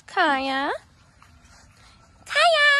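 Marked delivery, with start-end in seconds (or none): 2.3-2.7 high, loud call across the field. Real, not acted. Calling Kaya the cow from across the field, loud and high.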